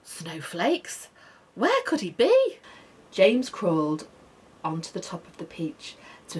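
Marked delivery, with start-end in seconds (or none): 1.6-2.6 alarmed wonder. A narrator's reading. James waking in alarm.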